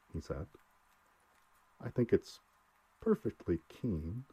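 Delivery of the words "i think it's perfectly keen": tense, slightly raised voice, slowing on the last words; taut. A narrator's reading. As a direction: say it tightly, with strained control. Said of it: "said Welling tautly".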